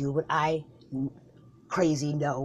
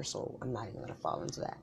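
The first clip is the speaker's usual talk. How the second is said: muttered aside; 6 semitones lower, 12 dB quieter than usual. Acted. Dismissive aside.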